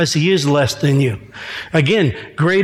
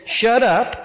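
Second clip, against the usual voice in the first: higher-pitched curt command; mild, pitched-up rebuke; rebuking; 5 semitones higher than usual. Acted. Jesus silences the unclean devil, rebuking. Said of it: "Jesus rebuked him and said".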